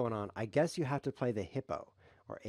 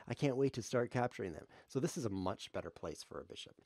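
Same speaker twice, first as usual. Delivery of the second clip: ordinary delivery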